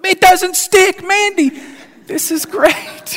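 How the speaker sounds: very high, slow, hammed-up wonder; amazed delight. Husband tells wife Mandy the pan won't stick, mock amazement. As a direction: marvel at the pan like it's a miracle. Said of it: "oh, flip".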